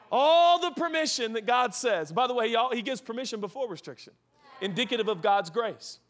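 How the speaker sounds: ordinary delivery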